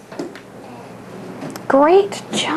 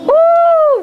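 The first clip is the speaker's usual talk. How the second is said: high-pitched drawn-out whoop; high "woo"; 12 semitones higher, 4 dB louder than usual. Real, not acted. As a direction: let out a long, high "woo". Teacher reacts during sound game.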